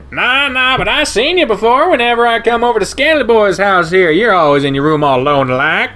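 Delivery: high, loud, brash stranger voice; German shepherd greeting the otter, high and loud; brash shepherd guy. Acted. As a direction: crank the pitch way up and bark it out with delight. Ryan teasing Daxton.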